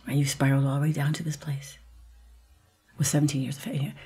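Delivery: soft whisper of an inner voice. Retold or imitated. An inner voice telling her why, in a hushed whisper. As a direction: let it come as a quiet voice inside. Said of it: "And I hear a voice say".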